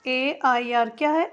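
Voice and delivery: ordinary delivery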